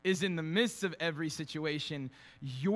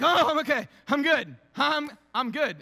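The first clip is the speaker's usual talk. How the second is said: louder, higher hammed-up insisting I'm fine; mock tough denial; 7 semitones higher, 7 dB louder than usual. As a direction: brush off the injury like a macho dude. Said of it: "I jump up and I'm like".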